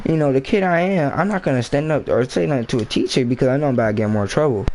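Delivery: usual speaking voice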